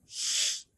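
嘘 as a long voiceless hiss; just the friction in 嘘, no vowel; isolated consonant demo. Shown as a demonstration. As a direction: draw out 嘘 as unvoiced air between tongue and palate. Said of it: "它的辅音呢，读作".

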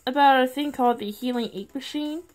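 ordinary talk, nothing marked

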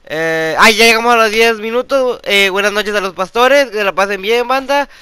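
loud, hammed-up sign-off to viewers; loud youtuber outro. Youtuber signing off to his viewers, loud hammed-up outro. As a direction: shout the outro like a hype youtuber.

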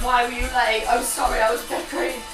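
normal talking voice

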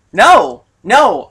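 loud exasperated no. Real, not acted. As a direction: shout it out in frustration. Exasperated refusal to continue.

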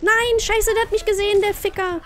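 high, loud panicked cursing; panicked shout. Real, not acted. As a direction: yell in sudden panic. Spotted by the killer, panics.

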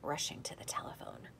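hushed, breathy narration; whispered. A narrator's reading. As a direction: drop to a whisper.

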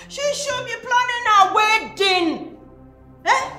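high, loud, drawn-out reproach; angry complaint. Acted. Mother berating them over the wedding, loud and aggrieved. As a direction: complain with rising outrage.

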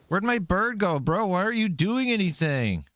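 high-pitched, incredulous complaint; exasperated disbelief. Real, not acted. Player to his bird that does nothing, exasperated. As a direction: wail it in high, exasperated protest.

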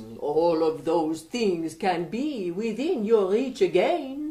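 put-on light French accent, playful stranger; a mischievous Frenchman teasing the king. French young man.

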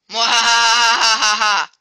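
slow, loud maniacal villain laugh; evil cackle. Pretend. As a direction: cackle like a mad scientist. Villainous gloating over enemy.

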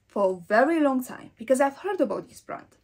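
usual speaking voice

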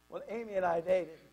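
plain reading voice

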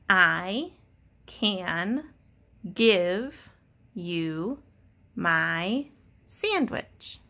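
each word set apart by long pauses; word by word reading. Shown as a demonstration. Teacher models reading the sentence word by word. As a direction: read it one word at a time. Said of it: "Read it with me".

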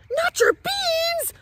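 very high, slow, loud outraged wail; outraged cry. Acted. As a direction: cry out in disbelief. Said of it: "cried Skipito, outraged".

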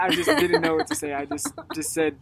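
usual speaking voice

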